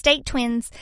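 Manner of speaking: mock cutesy catchphrase voice; silly put-on voice. Shown as a demonstration. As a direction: pop the phrase up in a playful put-on voice. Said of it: "I don't know why I used that voice then".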